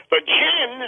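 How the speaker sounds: a sudden thrilled high jump on the word; excited. Retold or imitated. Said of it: "he gets all excited".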